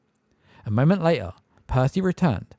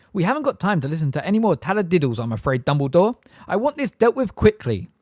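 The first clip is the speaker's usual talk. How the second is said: brusque; 7 semitones higher, 5 dB louder than usual. A narrator's reading. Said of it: "pulling himself back together".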